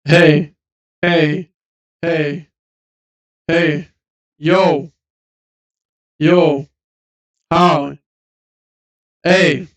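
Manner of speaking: repeated loud hey and yo calls; shouting tests. Shown as a demonstration. Friend shouting to test the compressor, loud. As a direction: keep yelling short calls. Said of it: "your shouting".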